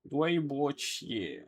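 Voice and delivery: drawn-out, soft repetition; slow pronunciation model. Shown as a demonstration. Teacher repeats the apology for learners, slowly drawn out. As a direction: stretch every syllable for learners.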